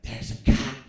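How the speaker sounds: unvoiced frightened whisper; breathless paranoid whisper; panicked whisper. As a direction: hiss it so the cop can't hear.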